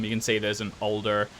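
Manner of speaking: ordinary talk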